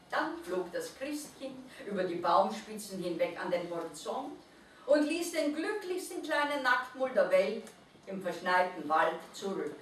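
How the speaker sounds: usual speaking voice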